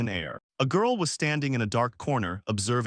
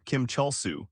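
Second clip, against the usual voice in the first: ordinary delivery, nothing marked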